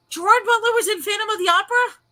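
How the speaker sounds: high, loud disbelieving question; stunned to learn it, voice jumping high; astonished question. Real, not acted. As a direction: blurt it out in real astonishment, pitch way up. Astonished by news about the actor.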